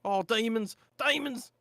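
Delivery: high, loud repeated terrified cries; mounting hammed-up terror; panicked demons. Rufus panicking at demon visions.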